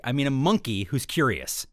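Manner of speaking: normal talking voice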